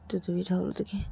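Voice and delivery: usual speaking voice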